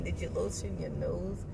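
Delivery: usual speaking voice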